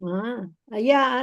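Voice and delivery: normal talking voice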